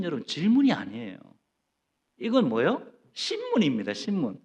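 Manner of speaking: ordinary talk, nothing marked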